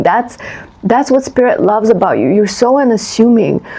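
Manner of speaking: ordinary talk, nothing marked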